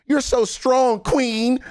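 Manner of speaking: very high-pitched, put-on cheerleading; mock gushing praise. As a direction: gush in a squeal of fake admiration. Mocking imagined praise of lone strugglers.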